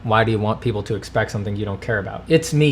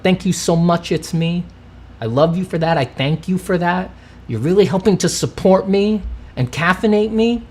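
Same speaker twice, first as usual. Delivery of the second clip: gushing thanks; 7 semitones higher than usual. Shown as a demonstration. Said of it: "I can say the nicest things in the world".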